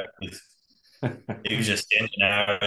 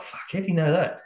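higher-pitched retold amazement; amazed; 10 semitones higher than usual. Mates baffled by the tracker spotting them, amazed. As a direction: react in mild disbelief, pitched up. Amazed friends react to hunter.

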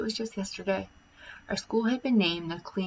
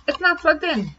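up high and louder, as if it were obvious; high, loud matter-of-fact reveal; 10 semitones higher, 5 dB louder than usual. A narrator's reading. Janitor tells the principal the obvious, high and loud. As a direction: toss out the obvious answer, pitched up and loud.

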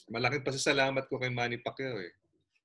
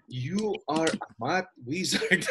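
slow, amused line, breaking into laughter; laughing praise; 4 semitones higher, much slower than usual. Retold or imitated. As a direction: praise them slowly while laughing. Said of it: "sabi ko na".

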